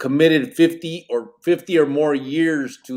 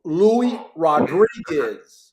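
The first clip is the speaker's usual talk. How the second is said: very slow, higher-pitched, stretched-out syllables; over-enunciate as if to someone hard of hearing; exaggerated slow enunciation; 4 semitones higher, 4 dB louder, much slower than usual. Real, not acted. Host repeating a name to baffled guest, exaggerated slow enunciation.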